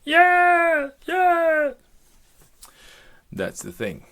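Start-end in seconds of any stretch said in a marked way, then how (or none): 0.0-1.7 very high, loud held call; piercing high echoed reply; answering echo. Acted. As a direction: holler back from far inside the mountain. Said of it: "And you hear someone say".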